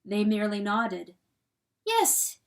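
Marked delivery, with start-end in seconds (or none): 1.9-2.4 high, resolved yes; declared. A narrator's reading. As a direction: declare it with a pitched-up voice. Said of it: "declared per-, Pertolote".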